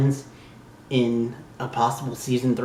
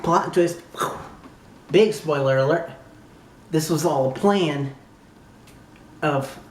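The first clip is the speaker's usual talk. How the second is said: ordinary talk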